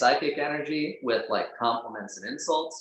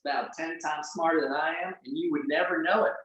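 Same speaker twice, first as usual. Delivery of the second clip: normal talking voice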